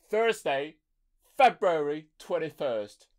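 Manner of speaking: high, slow, posh-sounding British announcer; mock British announcer intro; British accent. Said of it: "I put on a British accent there".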